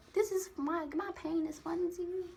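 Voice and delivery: high-pitched incredulous protest; incredulous hurt. Real, not acted. To the man who laughed at her pain, high incredulous hurt.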